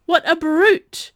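high, loud, drawn-out outrage; indignant exclamation. A narrator's reading. Delphine siding with her friend, indignant.

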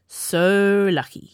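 slow, sour "so lucky"; grumbling. A narrator's reading. Kai grumbles at his cheerful tutor, slow and sour. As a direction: drag it out, unimpressed. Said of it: "he grumbled".

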